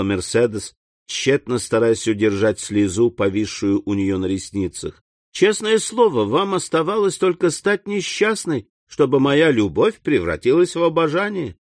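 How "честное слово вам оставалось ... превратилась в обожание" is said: son voiced a bit higher, adoring; young son's voice. A narrator's reading. A son tells his mother he adores her, lightly raised voice. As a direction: lift the voice for the loving young son.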